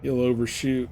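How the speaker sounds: ordinary talk, nothing marked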